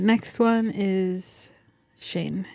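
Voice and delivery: ordinary talk, nothing marked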